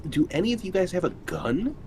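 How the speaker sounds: ordinary delivery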